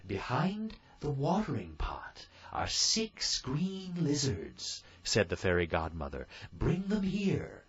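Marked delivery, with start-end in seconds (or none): 0.1-4.8 high, slow, kindly fairy voice; fairy godmother. A narrator's reading. Fairy godmother giving Cinderella orders, high and slow. 6.5-7.6 high, slow, kindly fairy voice; fairy godmother. A narrator's reading. Fairy godmother giving Cinderella orders, high and slow.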